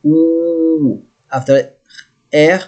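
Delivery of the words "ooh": "Ooh" held as one long u vowel; long oo sound. Shown as a demonstration. Teacher isolates the vowel in the word, long oo. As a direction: stretch "Ooh" out alone for learners. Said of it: "I say oo, not O".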